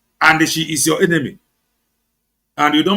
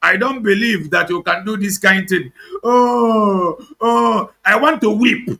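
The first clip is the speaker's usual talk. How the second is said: hammed-up lament, a touch higher; mock-tearful wail, a little higher; mock wailing mourner; 5 semitones higher, slower than usual. Mocking social media mourners with a hammed-up wail. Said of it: "I want to cry like this very social media".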